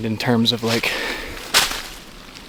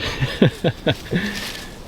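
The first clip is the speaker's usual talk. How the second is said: breathy laugh; 7 semitones higher, 4 dB quieter than usual. Real, not acted. Amazed at big rub.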